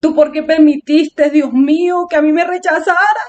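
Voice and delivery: imitating a tearful plea to God; plaintive mock crying, rising in pitch at the end; retold crying. Praying to God after being rejected, tearful retold crying. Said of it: "entonces me puse a llorar".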